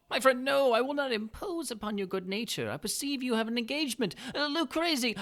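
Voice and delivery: butter him up with mock delight; Montresor's sly greeting. Montresor feigns concern.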